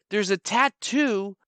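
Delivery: usual speaking voice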